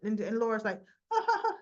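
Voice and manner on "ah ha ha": high, put-on "ah ha ha"; mock interrupting laugh. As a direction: cut in with a high fake laugh. Laura cuts in on Valentine.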